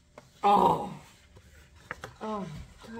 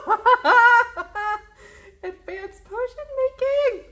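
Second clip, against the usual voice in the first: high, loud gasp and squeal; overjoyed squeal; real delighted shriek; 16 dB louder, much slower than usual. Real, not acted. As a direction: shriek with joy at the find. Recognizes the potion book.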